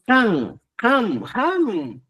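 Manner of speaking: "can", "cam", "ham", each high and falling; Cusco pronunciation. Shown as a demonstration. Teacher showing Cusco speech, "can, cam, ham" pitched high. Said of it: "en Cusco decimos".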